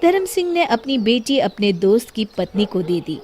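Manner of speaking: ordinary delivery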